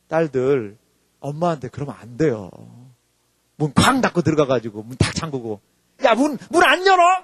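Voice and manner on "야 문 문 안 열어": higher, louder demand to open up; angry yell at door. Retold or imitated. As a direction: yell through the locked door. Daughter banging at locked door.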